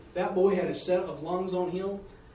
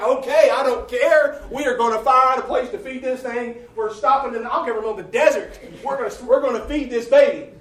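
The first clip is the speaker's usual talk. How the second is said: louder, frazzled parent giving in; exasperated parent; 4 semitones higher, 10 dB louder than usual. Retold or imitated. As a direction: shout it like a worn-out mom on the highway. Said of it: "all of a sudden you're like".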